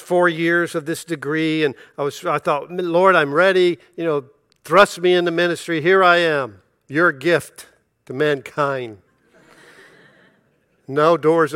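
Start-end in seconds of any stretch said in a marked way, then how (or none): 2.7-9.0 put-on eager young preacher hamming up his readiness; mock grand prayer. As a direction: proudly present yourself to the Lord, tongue in cheek.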